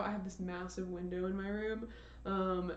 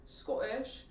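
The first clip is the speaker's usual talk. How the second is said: shaky try at a Scottish accent, higher; attempted Scottish accent; 4 semitones higher than usual. Shown as a demonstration. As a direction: put on a light, amateur Scottish accent. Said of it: "I could do probably a Scottish".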